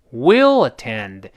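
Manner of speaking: will linked to attend, said very slowly; slow, careful will attend. Shown as a demonstration. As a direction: drawl Will attend, syllable by syllable. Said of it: "这是will，will".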